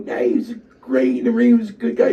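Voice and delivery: high-pitched, insistent defence; retold heated protest. Wrestler defends Joe to Chief, heated high protest. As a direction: act out arguing back for a friend. Acted protest back to boss.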